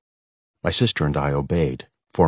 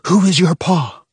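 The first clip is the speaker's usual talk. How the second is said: louder, rising soldier demand; harsher mock interrogation; 14 semitones higher, 6 dB louder than usual. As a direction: press harder, more threatening. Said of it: "even more intense".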